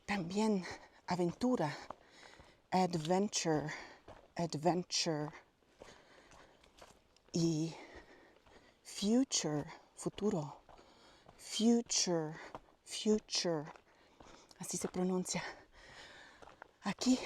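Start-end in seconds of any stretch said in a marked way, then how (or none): 2.7-5.3 "adventure" as ad-VEN-chur, slow. Shown as a demonstration. Teaching learners "adventure" with a ch sound. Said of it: "se pronuncia como una C H". 8.8-13.6 "future" as FYOO-chur. Shown as a demonstration. Teaching learners "future" with a ch sound. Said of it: "se pronuncia como una C H".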